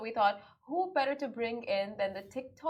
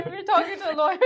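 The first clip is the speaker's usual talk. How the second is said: laughing; 6 semitones higher, 4 dB louder, much faster than usual. Real, not acted. Host laughs through line.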